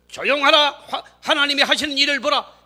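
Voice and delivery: high, loud, declaimed scripture; grand recitation. Shown as a demonstration. Preacher showing how he recites the fine sentence, grand declamation. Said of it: "저처럼 이렇게 멋진 문장으로".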